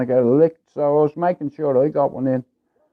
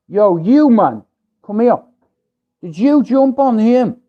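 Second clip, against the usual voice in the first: mock call-out; 5 semitones higher, 4 dB louder than usual. Neighbour demands if he hit her son.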